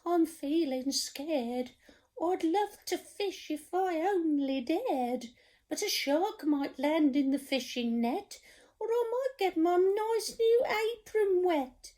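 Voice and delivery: high, trembling scared cook voice. Acted. Storyteller voicing the cook, scared of the sea, high voice. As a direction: quiver like a frightened wimp. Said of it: "the cook declared".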